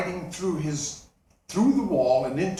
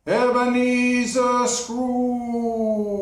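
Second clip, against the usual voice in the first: high, drawn-out ghostly call; eerie ghost voice; 6 semitones higher, 4 dB louder, much slower than usual. Acted. Marley's ghost calling Scrooge from afar, eerie and drawn out. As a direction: call him slowly from beyond the grave. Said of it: "an eerie voice said".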